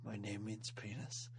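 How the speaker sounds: low, soft tough-guy voice; Snake voice again. Pretend. Host joking as Snake to co-host, low and soft. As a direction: keep it low and quiet.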